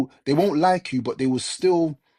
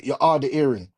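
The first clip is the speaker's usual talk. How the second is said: Jamaican saying. Shown as a demonstration. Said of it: "as we say in Jamaica".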